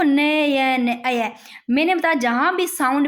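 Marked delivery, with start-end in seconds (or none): 0.0-0.9 "नयन" said slowly with long syllables; sounded out. Shown as a demonstration. Teacher asking students to listen, "नयन" stretched out. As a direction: draw out "नयन" so each sound shows. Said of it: "बोल के देखो".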